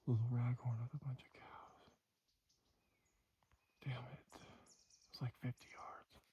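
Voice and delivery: hushed disappointed whisper. Real, not acted. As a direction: whisper it, elk are close. Whispering close to elk.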